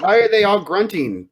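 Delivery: tag. Acted. Bewildered character asks about grunting.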